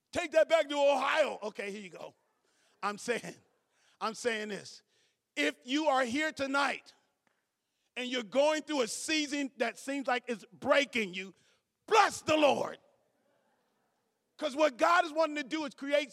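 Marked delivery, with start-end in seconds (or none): 0.1-1.4 loud laughing tease; laughing jab. Real, not acted. Pastor teasing a visitor, laughing loudly. 11.9-12.8 louder, higher call to bless; loud exhortation. Real, not acted. Pastor urging hurting listeners, loud call.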